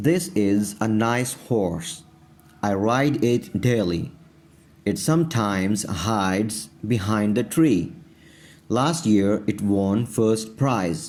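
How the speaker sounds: very slow, word-by-word reading aloud; teacher's drawn-out dictation pace; slow model reading. Shown as a demonstration. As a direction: read it out slowly for learners to follow. Teacher reads practice paragraph aloud.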